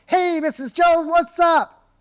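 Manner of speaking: high, loud, over-hyped sales greeting; hammed-up motivational-stage excitement on a phone call; fired up. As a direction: blast the prospect with way too much energy. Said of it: "you get all fired up like you're going Tony Robbins".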